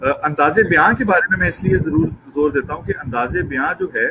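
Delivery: usual speaking voice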